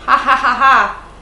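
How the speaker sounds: slow, loud fake laugh; mock laugh. Vlogger retells laughing at her rival, slow fake laugh. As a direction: laugh it off scornfully, drawn out. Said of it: "gua gitu".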